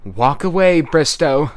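high, loud shouted order; calling out. A narrator's reading. Lucy ordering Bristow to walk away, calling out loudly. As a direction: call it forcefully across the distance. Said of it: "Lucy called out".

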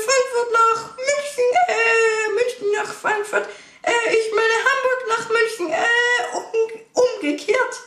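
squeaky high cartoon mouse voice, flustered; very high cartoon mouse pilot, stumbling; Mickey Mouse voice. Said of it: "eine Stimme wie Mickey Maus".

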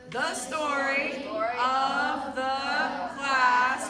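loud, drawn-out, syllable by syllable; slow choral-reading lead. Shown as a demonstration. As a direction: lead a class reading aloud together, very slowly. Leads slow choral reading with class.

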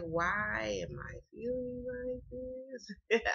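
drawn-out, very slow wondering voice; slow, bewildered musing to herself; puzzled self-quote. Retold or imitated. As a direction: stretch it out like you're baffled at yourself. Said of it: "So I was just like".

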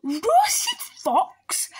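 high, loud shocked question; startled fox, pitch jumping up; alarmed echo. Acted. Fox hears he is the favourite food, alarmed.

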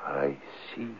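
a soft, deflated "I see"; subdued. Acted. The husband quietly deflated after the old man's warning. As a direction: caught out by the old man, he goes quiet and low. Husband told to consult wife.

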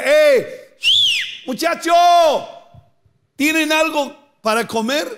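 high, loud call across the water. Retold or imitated. Jesus hailing the empty-handed fishermen, loud high call. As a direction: hail the boat from the beach.

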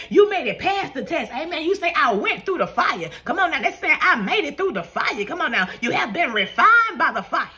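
high, emphatic sermon cadence; punchy, building preacher's fervor; declaratory preaching. Real, not acted. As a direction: declare victory to a congregation.

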